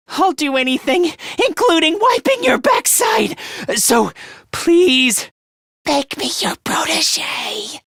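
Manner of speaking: high, strained grovelling plea; pleading to become a protege; desperate begging. Acted. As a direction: beg frantically, voice climbing high.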